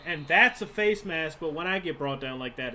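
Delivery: exasperated yell at the referee; loud protest. Real, not acted. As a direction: call out the missed penalty. Protests a missed penalty call.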